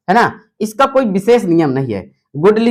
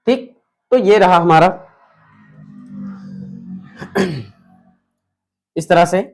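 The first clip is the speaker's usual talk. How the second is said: usual speaking voice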